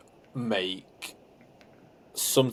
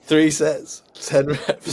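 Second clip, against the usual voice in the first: higher and louder, deadpan rep count like a gym influencer; hammed-up gym-bro rep count, higher and louder; mock monotone gym bro; 6 semitones higher, 5 dB louder, much slower than usual. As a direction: parrot the bro's rep call in one flat tone, pushed up and loud. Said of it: "he says it, like, really monotone".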